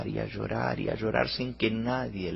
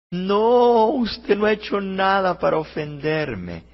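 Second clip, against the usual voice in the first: high, loud acted wail; storyteller's tearful, loud protest; crying harder; 9 semitones higher, 10 dB louder, slower than usual. A narrator's reading. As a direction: wail the denial, very high at the start. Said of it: "Y más lloraba Anita".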